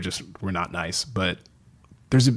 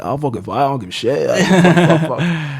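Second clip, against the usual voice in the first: hammed-up brash British impression; cocky British accent; 5 semitones higher, 5 dB louder than usual. Imitating the younger guy volunteering to fight, cocky mock British. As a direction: play a cocky British lad, swearing freely. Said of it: "he's British".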